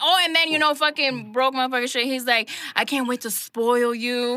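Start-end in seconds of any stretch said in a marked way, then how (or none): 2.8-4.4 slow mock lisp, spitting the sibilants; spitting toothless lisp. Mimicking the toothless date promising treats, spitting lisp. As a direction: imitate a gap-toothed sweet-talker, slowly. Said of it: "Spitting in my face and shit".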